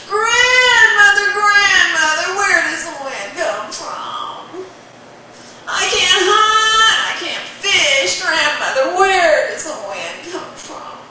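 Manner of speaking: put-on high, slow whiny child begging; pleading boy. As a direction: whine up at grandmother like a pestering kid.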